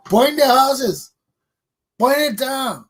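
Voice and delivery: high, loud, drawn-out cry; loud outcry. Real, not acted. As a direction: call it out like a rallying cry. Echoing threats of house burning.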